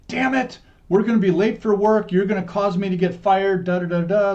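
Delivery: loud, high angry scolding; dad yelling. Retold or imitated. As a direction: imitate an exasperated dad yelling. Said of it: "your dad starts screaming and yelling at you".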